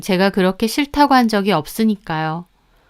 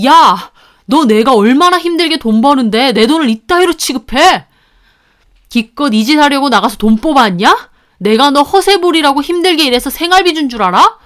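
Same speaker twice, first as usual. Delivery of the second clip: loud, raised angry yell; shouting husband; 5 semitones higher, 5 dB louder than usual. A narrator's reading. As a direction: bellow at the wife in outrage. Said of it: "남편이 소리를 막 지르더라고요". (소리 지르는 남편)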